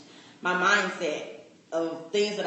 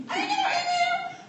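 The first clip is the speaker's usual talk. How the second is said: high, louder, laughing disbelief; surprised denial; 4 dB louder than usual. Retold or imitated. On the phone with her coach, laughing surprised denial. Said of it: "I was like".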